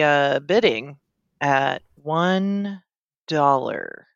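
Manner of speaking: auctioneer dragging every word with long pauses; slow auctioneer. Acted. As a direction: auction it off at a crawl, pausing between words. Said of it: "I'm the world's slowest auctioneer".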